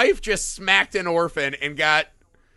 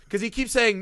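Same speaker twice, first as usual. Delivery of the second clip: ordinary talk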